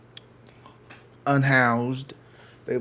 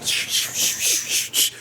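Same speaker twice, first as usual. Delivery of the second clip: rapid voiceless shushing bursts; imitated pocket noise; slower than usual. Woman walking past with loot in her pocket, imitated rustle. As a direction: mimic stolen goods rustling as someone walks. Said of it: "I could hear whatever the fuck she had in her pocket".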